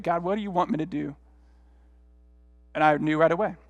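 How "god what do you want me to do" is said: soft, reluctant aside to God; subdued, grudging little prayer; half-hearted prayer. Retold or imitated. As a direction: pray it quietly without much conviction. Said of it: "I kinda half-heartedly prayed".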